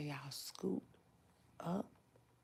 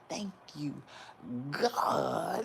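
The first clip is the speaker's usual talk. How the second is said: thankful quote; 5 dB louder, slower than usual. Retold or imitated. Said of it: "I said".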